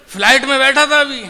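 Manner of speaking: ordinary talk, nothing marked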